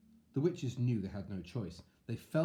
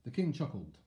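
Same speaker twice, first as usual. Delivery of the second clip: ordinary delivery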